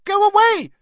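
high, loud squeak. A narrator's reading. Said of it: "Hermione squeaked".

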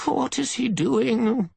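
slow, piercing high screech; very high, querulous shriek; shrill. A narrator's reading. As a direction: demand it loudly in a shrill old voice. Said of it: "demanding in a shrill voice".

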